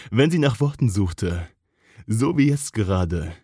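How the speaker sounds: usual speaking voice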